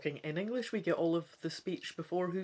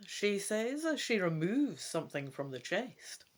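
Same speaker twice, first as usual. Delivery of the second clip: ordinary talk, nothing marked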